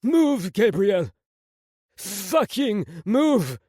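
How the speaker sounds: high, loud command, last words drawn out; urgent inner yell. A narrator's reading. Prince urging his own body to move, desperate yell. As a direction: yell at yourself to get moving. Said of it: "My mind yelled".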